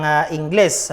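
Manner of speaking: ordinary talk, nothing marked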